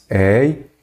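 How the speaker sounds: each diphthong "Ei" and "oi" drawn out very slowly; slow dictation of "Ei e oi"; slow spelled-out diphthongs. Shown as a demonstration. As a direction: lay out "Ei e oi" slowly for the class. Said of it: "E aquelas terminadas nos ditongos abertos".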